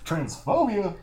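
high hype-man yell; hyped crowd warm up. Pretend. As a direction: pump up the crowd with it. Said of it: "never yelled transphobia in a hype man voice".

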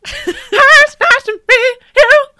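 very high, loud, slow screech; rock singer talking. Acted. Imagining the rock singer meeting someone, screeching impression. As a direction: screech small talk like a rock frontman. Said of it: "what does he sound like when he talks".

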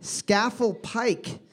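slow, drawn-out name; slow careful reading. Shown as a demonstration. Quiz host reading a hard place name slowly. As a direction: spell it out with your voice slowly. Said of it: "S C A F E L L".

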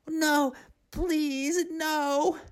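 put-on high pleading girl's voice; wailing plea. A narrator's reading. Serena begging her mother not to curse her, high wailing plea. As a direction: beg desperately like a scared child. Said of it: "Serena wailed".

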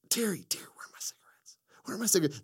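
high call then a hushed whispered aside; mock-frantic hunt for cigarettes; Joaquin calling assistant. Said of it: "And then he's like".

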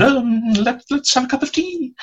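tiny, very high-pitched hesitant voice; flustered actress. Retold or imitated. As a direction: squeak it out like an unprepared guest on air. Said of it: "gulped a bit and said".

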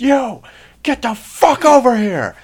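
high, drawn-out full-throated yell; screaming corporal. Retold or imitated. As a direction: bellow it like an enraged NCO. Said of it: "is just him screaming".

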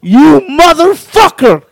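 high, loud, drawn-out curse; mock outraged yell. Storyteller imitates pranked friend on seeing the chair, mock outraged. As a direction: stretch it out in hammed-up outrage.

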